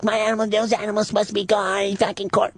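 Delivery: high, put-on wife voice; imitating the wife on the phone; wife's voice. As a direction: voice the wife's quick warning.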